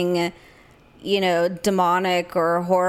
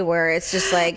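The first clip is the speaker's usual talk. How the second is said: ordinary talk, nothing marked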